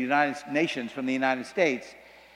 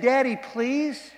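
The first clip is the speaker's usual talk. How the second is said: higher and slow, drawn-out plea; begging daughter; 8 semitones higher, slower than usual. Retold or imitated. Daughter asks her father once more, slow higher plea. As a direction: stretch out the begging as a last try.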